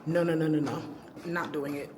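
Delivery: ordinary talk, nothing marked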